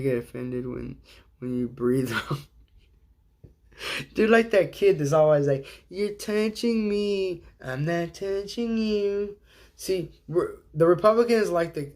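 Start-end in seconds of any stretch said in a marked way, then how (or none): 5.9-9.4 put-on slow, high taunting kid voice; whiny kid. Kid taunting a sibling, mock whiny. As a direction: drag it out like a bratty child.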